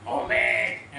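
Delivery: low, heavy, booming "amen"; forceful amen. Shown as a demonstration. Preacher showing congregation how not to respond, forceful amen. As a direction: show an overdone, forceful amen. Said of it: "అని గట్టిగా ఏం చెప్పక్కర్లే".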